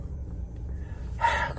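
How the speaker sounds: long, breathy tired sigh; a worn-out sigh before talking to God; weary sigh. Real, not acted. Weary prayer to the Lord.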